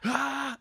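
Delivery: sudden high shouted "Ah!"; imitated sneeze yell. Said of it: "it's a full Helm, like, yell".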